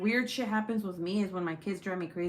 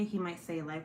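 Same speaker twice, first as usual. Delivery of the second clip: normal talking voice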